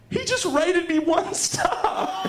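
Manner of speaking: slow, high, stunned report; stunned disbelief. Retold or imitated. Mia telling her friend the driver rated her one star, slow and stunned. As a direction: let the shock drag each word out.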